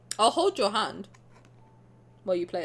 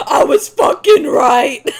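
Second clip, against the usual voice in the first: screaming in a demonic, triumphant roar; full-force monstrous victory scream; 5 semitones higher, 14 dB louder, slower than usual. Pretend. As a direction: yell it slowly like a demon on the loose. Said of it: "screaming in my best demon".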